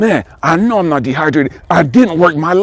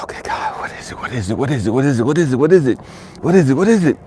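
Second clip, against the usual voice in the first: desperate questioning; faster than usual. Retold or imitated. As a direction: whisper building into rapid repeated pleas. Acts out exhausted desperate questioning of God.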